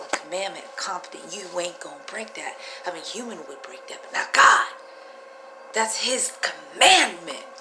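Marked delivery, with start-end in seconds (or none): none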